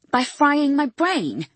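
slow, loud, high bitter retort; angry. Acted. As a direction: spit it out slowly in disbelief. Patient glares, refuses treatment.

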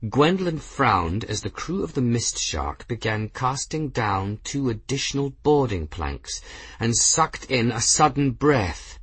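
plain reading voice

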